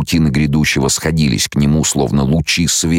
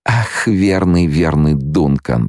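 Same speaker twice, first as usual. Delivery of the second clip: ordinary delivery, nothing marked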